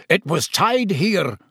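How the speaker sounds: furious. A narrator's reading. Executioner finds his victim gone, furious. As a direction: insist angrily, stretching the words. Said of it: "said the executioner furiously".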